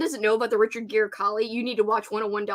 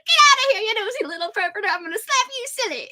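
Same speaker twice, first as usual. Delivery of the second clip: high, shrill-free squeaky clown voice, much higher; voicing the clown; 11 semitones higher, faster than usual. Home Alone clown yelling at the intruder, high squeaky voice. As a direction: squeal it out as the angry inflatable clown. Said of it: "He's like".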